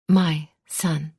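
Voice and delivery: ordinary delivery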